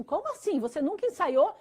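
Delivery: high-pitched, incredulous questioning; retold disbelief. Band members questioning the boy who never rehearsed, astonished. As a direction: imitate a startled group challenging him, pitch jumping up.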